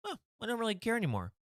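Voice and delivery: high and fast, brushing it off; careless magician. Acted. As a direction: rattle it off high and carefree. Magician again, faster.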